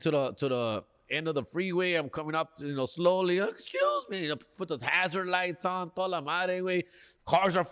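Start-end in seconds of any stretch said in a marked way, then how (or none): 3.4-4.2 tiny falsetto apology; squeaky mock plea. Driver crawling toward the freeway's edge, meek falsetto plea. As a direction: squeak it out in a very high put-on voice.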